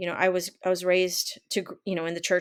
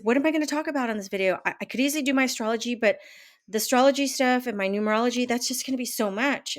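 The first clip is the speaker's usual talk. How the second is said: quick, higher, rushed worrying to herself; a hurried, higher-pitched retelling of her own fretting; fretting self-talk; 5 semitones higher, faster than usual. Retold or imitated. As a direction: fire it off like a panicked inner voice. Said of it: "I said".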